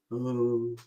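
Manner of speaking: deflated mock boredom; exaggerated boredom. Shown as a demonstration. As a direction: drag it out, utterly bored. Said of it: "you can take it to".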